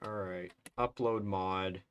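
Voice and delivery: ordinary talk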